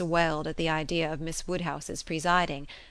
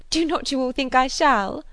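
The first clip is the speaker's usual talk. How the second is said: high, loud, good-humoured appeal; Miss Bates; 8 semitones higher, 10 dB louder than usual. A narrator's reading. Spinster appealing to the company, high and eager. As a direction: ask it sure of everyone's assent. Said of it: "most good-humoured dependence on everybody's assent".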